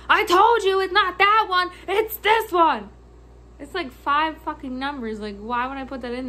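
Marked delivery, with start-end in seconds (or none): 0.1-2.9 loud, high scolding yell; mock an irate customer, dragging out the end; retold yelling customer. Customer correcting clerk on card numbers, mock yelling. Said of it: "you just yelled at me".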